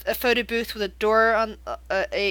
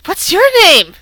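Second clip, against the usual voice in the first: much higher, much louder hello; creepy madman call; 9 semitones higher, 14 dB louder than usual. Acted. Madman calling up the stairs, loud and high. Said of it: "You hear him go".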